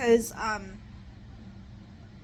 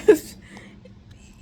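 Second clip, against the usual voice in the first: loud sudden laugh; burst of laughter; 8 semitones higher, 15 dB louder than usual. Real, not acted. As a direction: break into a laugh. Laughs mid-thought.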